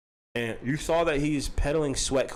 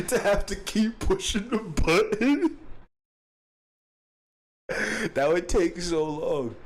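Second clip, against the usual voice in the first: laughing; slower than usual. Real, not acted.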